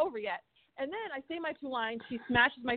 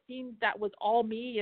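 ordinary talk

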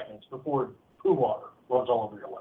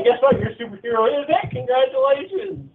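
mock cheerful; 12 semitones higher, 3 dB louder than usual. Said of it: "I'm just like".

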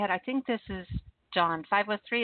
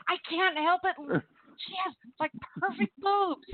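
higher, giddy admission; gushing excuse; 9 semitones higher than usual. Retold or imitated. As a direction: confess it with delighted helplessness. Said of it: "And I said".

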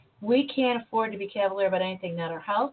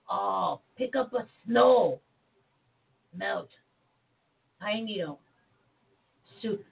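frog voice; slower than usual. Retold or imitated. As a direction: croak it like the lama. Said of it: "I do the frog voice pretty good".

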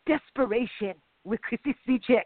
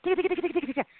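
quick, higher ticka ticka clicks like a typist; typing noise imitation; 8 semitones higher, much faster than usual. Mocking the transcribers, keyboard clicks imitated. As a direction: mimic keys clattering in a rapid patter. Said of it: "They're typing it out".

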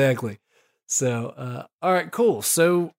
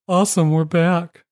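upbeat, slow announcer welcome with a higher, louder lift; radio host voice; 5 semitones higher, 5 dB louder, slower than usual. Acted. Host welcomes listeners back from the break, radio voice. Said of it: "That's his non-radio voice".

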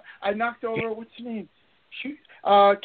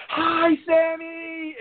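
high, slow drawn-out shout of his name; starstruck call out; 10 semitones higher, much slower than usual. Retold or imitated. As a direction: call out to him like a thrilled kid. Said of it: "finally I got up to nerve to just be like".

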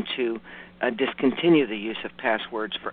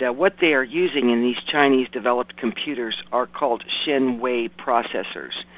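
ordinary delivery

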